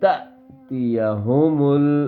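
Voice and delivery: تأتیہم with a sharp catch on the hamza; hamza jolt. Shown as a demonstration. Teacher demonstrates the hamza catch in تأتیہم, slow. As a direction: break تأتیہم with a glottal jolt. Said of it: "جھٹکا لگا کرتا ہے".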